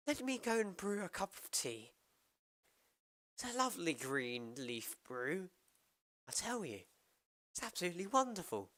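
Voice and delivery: high, hammed-up posh British voice; over-the-top upper-class British accent. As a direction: play a tea-loving English toff for chat. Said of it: "you want me to be more British?".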